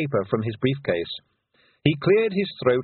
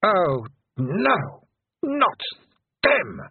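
high exasperated cry; dismayed Queen; 8 semitones higher, much slower than usual. A narrator's reading. Queen dismayed at the Feegles, high and exasperated. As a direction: throw up your hands, annoyed.

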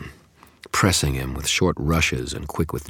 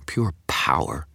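whispering. A narrator's reading. Awed narrator climax.

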